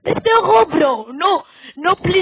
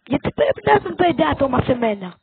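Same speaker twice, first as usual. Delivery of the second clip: ordinary talk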